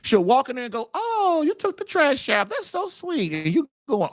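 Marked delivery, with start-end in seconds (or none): 0.9-3.3 imitating pleased wife. Wife pleased at trash taken out.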